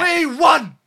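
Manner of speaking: high, loud victory yell; triumphant shout. Real, not acted. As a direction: scream it with joy. Celebrating a win.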